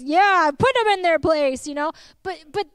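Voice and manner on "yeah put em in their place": gloating cheer. Retold or imitated. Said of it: "we laugh because".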